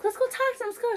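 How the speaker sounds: grandma urging her granddaughter to go talk to them, high and eager; imitating grandma. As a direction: mimic a cheerful eager grandma. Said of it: "she's so calm".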